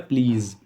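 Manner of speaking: प्लीज़ said as one long clear "pleez"; correct pronunciation. Shown as a demonstration. Teacher modelling the word for students, clear and deliberate. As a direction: model प्लीज़ cleanly for the learners. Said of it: "इसका सही उच्चारण होता है".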